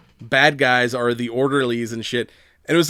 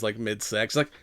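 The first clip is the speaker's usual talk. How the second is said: ordinary talk, nothing marked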